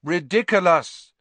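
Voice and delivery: loud chorus of pupils; the whole class yells the spell back; class shouting together. A narrator's reading. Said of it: "said the class together".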